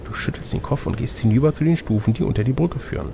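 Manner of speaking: usual speaking voice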